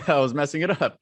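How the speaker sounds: amused punchline with a real laugh. Real, not acted.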